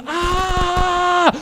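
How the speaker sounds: hammed-up falling-sailor scream, kept in check; mock scream. Director shows sailor his one line, mock scream. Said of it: "you're gonna scream".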